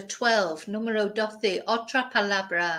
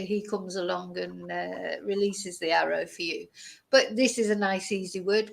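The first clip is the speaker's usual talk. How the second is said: usual speaking voice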